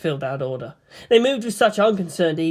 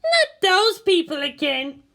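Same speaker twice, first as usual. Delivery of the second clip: a lightly voiced annoyed girl; high girl's voice, slow and fed up; 13 semitones higher, slower than usual. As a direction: grumble like a bored pilot girl. Asuka voiced.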